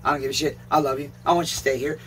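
ordinary talk, nothing marked

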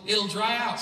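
hammed-up pushy advice giver; insistent retold advice. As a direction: push it like an eager know-it-all. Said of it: "He's like".

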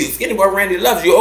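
ordinary talk

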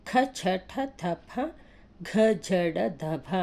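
ఖ ఛ ఠ థ ఫ ఘ ఝ డ ధ భ each with a strong breath; aspirated letters. Shown as a demonstration. Teacher listing aspirates for pupils, forceful breathy demo. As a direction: push air on every ఖ ఛ ఠ. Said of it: "కొంచెం గట్టిగా పలకాలి".

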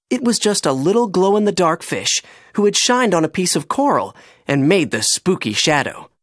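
plain reading voice

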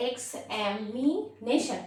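Examination slowly as x, এম, ই, nation; split in four beats. Shown as a demonstration. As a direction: pause between the four parts of examination. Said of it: "চারবারে". (Examination চারবারে, ভাগ করে)

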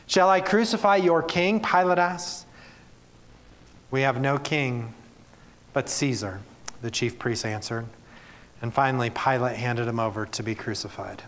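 measured Bible reading to the congregation; earnest public reading of scripture. Acted. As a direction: read the passage with weight and care. Preacher reads scripture passage aloud.

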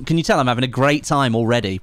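ordinary talk, nothing marked

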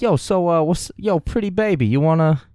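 high, smarmy come-on; sleazy pickup voice. Retold or imitated. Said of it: "he'd be like".

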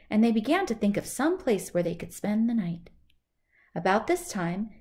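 plain reading voice

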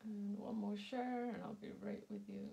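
ordinary talk

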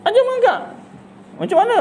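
retold shock at being told to crawl again; incredulous. Parent hearing the doctor's advice, squeaking in disbelief.

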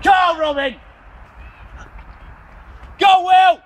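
loud, high shouts of encouragement; urging runners on from the course; cheering shout. Real, not acted. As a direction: bellow support at passing runners.

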